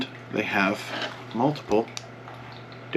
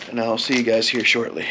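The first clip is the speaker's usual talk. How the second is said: ordinary talk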